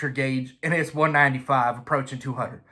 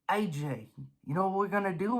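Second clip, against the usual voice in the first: hushed, conspiratorial idol whisper-talk; idol's voice; 10 dB quieter, faster than usual. Retold or imitated. Idol Larry tempting the racer, hushed and sly. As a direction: lean in and tempt him softly. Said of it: "comes to the front of my brain and says".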